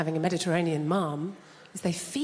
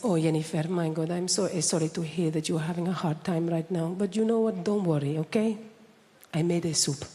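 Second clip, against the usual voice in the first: put-on lower, Spanish-accented consoling mum; Spanish mum on the phone; 4 semitones lower than usual. Mum consoling her depressed daughter on the phone, Spanish accent. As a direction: comfort her like a Spanish mum offering soup. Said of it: "conversation always goes like this".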